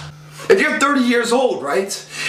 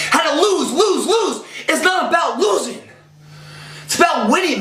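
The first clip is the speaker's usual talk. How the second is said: passionate shout; 7 semitones higher than usual. Real, not acted. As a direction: yell it out like a fired-up coach. Rants against the losing mindset.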